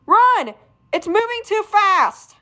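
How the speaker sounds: high, loud cry to flee; alarmed shout. Acted. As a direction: yell at them to run. Fleeing the moving void.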